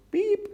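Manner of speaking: very high, short beep; high electronic-style blip; scanner beep. A narrator's reading. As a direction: imitate a checkout scanner's beep. Imitating the checkout scanner.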